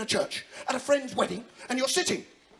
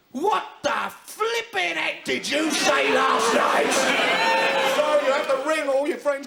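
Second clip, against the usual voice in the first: slow, high, loud outrage; scolding shout; 5 semitones higher, 6 dB louder, slower than usual. Acted. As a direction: bawl it out word by word. Captain scolds hungover sleeper.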